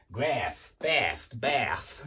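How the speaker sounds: hammed-up American vowels, word by word; British speaker's overdone American accent; exaggerated American accent. Shown as a demonstration. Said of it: "That's my exaggerated American accent".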